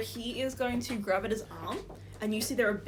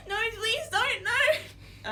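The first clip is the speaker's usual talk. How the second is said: put-on high, loud panicked kid; pleading child; 12 semitones higher, 5 dB louder, much slower than usual. Kid begs the player not to go, panicked. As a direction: beg in terror like a small child. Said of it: "scrambles behind you, says".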